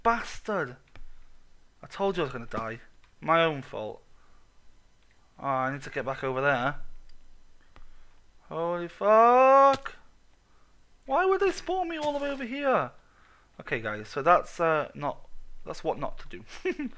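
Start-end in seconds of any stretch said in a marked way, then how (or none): 0.0-0.8 drawn-out loud outraged oh, then a curse; outraged cry. Real, not acted. Gamer yells at the mob that killed him, outraged. 8.5-12.9 long drawn-out loud holy fuck, then a high incredulous question; can't believe where the game dropped you; stunned disbelief. Real, not acted. Gamer respawns far away, stunned and incredulous.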